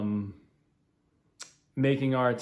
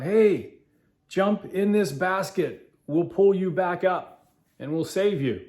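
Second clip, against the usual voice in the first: calling down; 7 semitones higher, slower than usual. Retold or imitated. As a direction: shout down to the man on the roof. Helicopter crew calling down.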